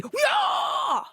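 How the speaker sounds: high, drawn-out cheering yell; high celebratory shout; triumphant cheer. Acted. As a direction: let out a long victory whoop. Cheer after sports call.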